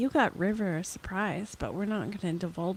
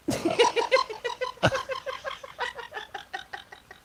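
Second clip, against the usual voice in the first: high loud burst of laughter; loud shrieking laugh; 4 dB louder than usual. Real, not acted. Big laugh.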